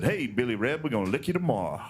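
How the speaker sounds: normal talking voice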